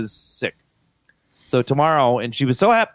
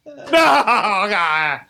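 high mock-anguished protest; hammed-up childish despair; imitated wailing child; 10 semitones higher than usual. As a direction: wail it like a distraught little girl. Child refusing to fetch toy.

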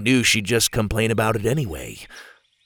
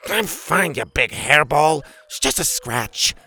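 gruff Bethany voice; 5 semitones higher than usual. Acted.

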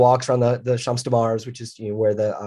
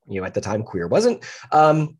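ordinary talk

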